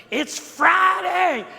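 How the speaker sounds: high, slow, loud preacher call; preacher's shout. Retold or imitated. Pastor rousing the congregation from the pulpit, loud call. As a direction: belt it out to rouse the church. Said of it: "all he would have to do is get up in the pulpit and go".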